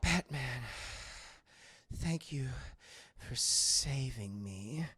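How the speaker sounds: low, slow seductive voice; low slow mock-seductive Catwoman; sultry Catwoman. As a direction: purr it slowly in mock gratitude. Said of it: "and she's like".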